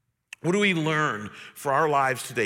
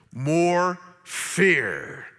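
ordinary talk

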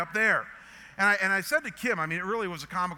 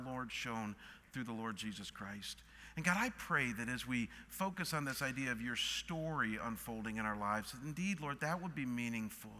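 soft, lower praying voice; hushed, earnest address to God; pastoral prayer; 5 semitones lower, 8 dB quieter than usual. Real, not acted. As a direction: lead the congregation quietly in prayer. Leads closing prayer.

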